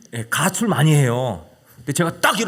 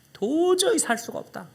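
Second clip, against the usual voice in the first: pleading mother; 12 semitones higher, slower than usual. Retold or imitated. Mother's line repeated, slowed.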